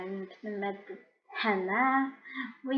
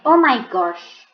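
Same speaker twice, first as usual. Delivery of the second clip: shocked; 8 dB louder than usual. Real, not acted. Reacting to the twist.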